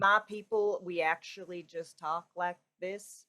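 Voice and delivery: slow, low Texas drawl, flattened out; flat, slow Texan. Shown as a demonstration. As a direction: talk flat and slow like a small-town Texan. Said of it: "It's just a little flat and slow".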